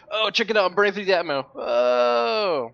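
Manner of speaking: sudden thrill at something on screen; excited. Real, not acted. Excited game moment mid-review.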